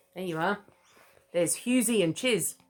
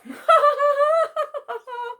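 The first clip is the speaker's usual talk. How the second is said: loud, very high cackling kookaburra laugh; kookaburra cackle; 8 dB louder than usual. Acted. Kookaburras laughing their heads off, loud high cackle.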